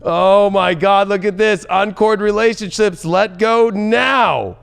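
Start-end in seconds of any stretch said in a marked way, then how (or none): 0.0-1.1 drawn-out, louder, higher gasp of awe; surprised. Real, not acted. Reader sees the uncording cards, amazed and louder. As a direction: stretch it out in real amazement.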